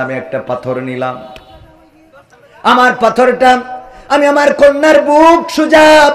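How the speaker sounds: melodic sermon storytelling. A narrator's reading. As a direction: tell the tragic tale in a singsong preacher's cadence. Preacher resumes the chanted tale.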